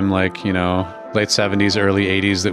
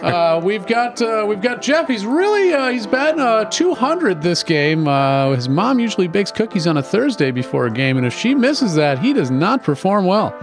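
color commentator; 12 semitones higher than usual. Retold or imitated. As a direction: ham up the radio color man. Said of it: "somebody steps in, is like".

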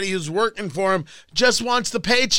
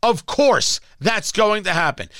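ordinary talk

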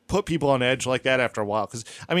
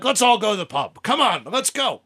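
quoted invite; 7 semitones higher than usual. Retold or imitated. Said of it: "he'd be like".

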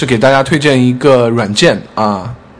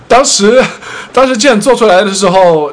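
laughing; 8 semitones higher, 4 dB louder than usual. Real, not acted. Telling a funny story, laughing.

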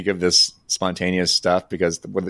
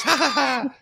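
much higher, hammed-up villain laugh; mock villain cackle. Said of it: "but he goes".

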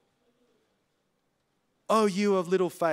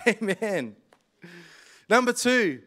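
usual speaking voice

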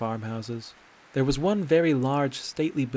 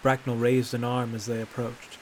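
ordinary delivery

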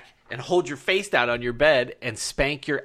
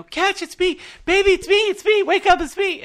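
high, loud panicked-mother imitation; shrill-pitched retold scream, urgent and loud; imitated screaming; 9 dB louder than usual. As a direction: mimic her screaming to wake him, pitched way up. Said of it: "I hear Ashley screaming back at him".

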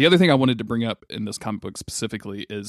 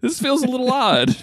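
ordinary talk

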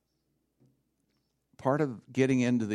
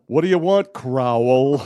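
Carol Kane; 3 semitones higher, 12 dB louder, slower than usual. Retold or imitated. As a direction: belt it out as a hammed-up caricature. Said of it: "Remember Carol Kane on Seinfeld?".

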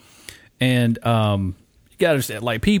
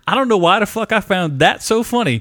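ordinary talk

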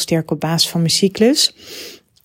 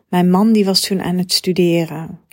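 ordinary talk

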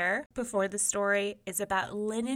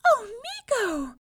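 very high, drawn-out, loud mouse exclamation; happy; 8 dB louder than usual. A narrator's reading. Mouse is accepted as sweetheart, cries out happily. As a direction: gush happily as a tiny mouse. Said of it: "she said happily".

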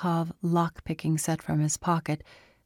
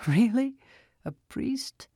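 much higher, scoffing rise on priest; amused incredulity, pitch it high; mocking disbelief; 7 semitones higher than usual. A narrator's reading. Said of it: "Arius burst out laughing".

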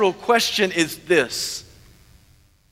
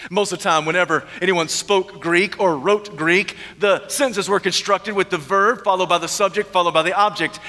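ordinary talk, nothing marked

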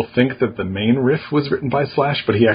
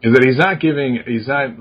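ordinary talk, nothing marked

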